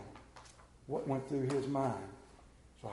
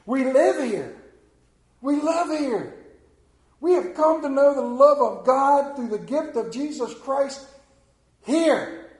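much higher, louder preaching; preaching climax; 10 semitones higher, 10 dB louder, slower than usual. Real, not acted. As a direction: ring it out from the pulpit.

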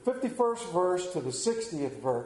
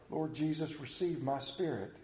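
soft, lowered dying prayer; praying; 3 semitones lower, 8 dB quieter than usual. A narrator's reading. Stephen praying as he is stoned, softly prayed. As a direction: read it hushed, as a plea to God. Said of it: "Stephen prayed".

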